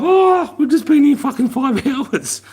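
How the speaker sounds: high-pitched, laughing mock complaint; re-enacted giddy incredulity; giggling disbelief. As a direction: squeal it through giggles. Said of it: "So we started giggling".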